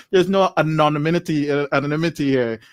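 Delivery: normal talking voice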